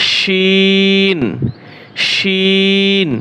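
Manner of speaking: শিন held very long with a clear শ; drawn-out letter. Shown as a demonstration. Teacher contrasting শিন with সিন for students. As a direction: stretch শিন slowly, not সি. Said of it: "এটা হচ্ছে সি, আর হচ্ছে শি।".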